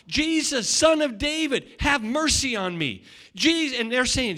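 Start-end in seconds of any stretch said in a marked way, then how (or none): 0.1-3.0 imitating a blind man calling out, raised pitch; mock shout, higher and pushed; retold shout. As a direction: cry out for mercy over a crowd. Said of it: "he just begins to shout".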